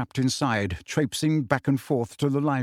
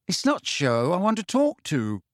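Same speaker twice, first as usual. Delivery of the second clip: curt, a little higher; brusquely; 5 semitones higher than usual. A narrator's reading. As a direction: cut her off short. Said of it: "said Harry brusquely".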